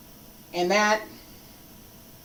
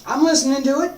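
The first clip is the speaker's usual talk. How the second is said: usual speaking voice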